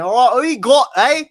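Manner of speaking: put-on loud, high British accent. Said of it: "I don't have a, a British accent".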